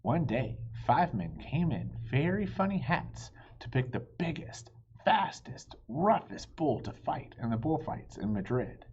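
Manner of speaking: ordinary delivery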